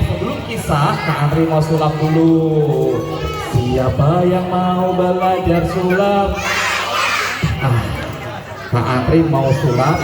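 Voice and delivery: plain reading voice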